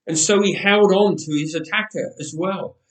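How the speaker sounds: ordinary delivery, nothing marked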